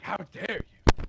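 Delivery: mock indignation. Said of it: "Felix is gonna be like".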